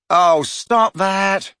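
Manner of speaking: high, loud, drawn-out plea; begging. A narrator's reading. Boy begs friend to stop practising, pleading. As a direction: beg her, stretching the words. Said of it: "Ron begged her".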